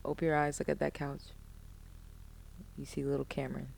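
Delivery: gentle guiding. Real, not acted. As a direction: softly guide them into a visualization. Guiding guest through a visualization.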